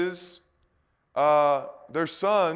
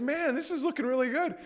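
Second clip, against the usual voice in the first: impressed envoys; 11 semitones higher, faster than usual. Retold or imitated. Babylonian envoys admire the palace in high, wowed voices. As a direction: sound wowed by what you see.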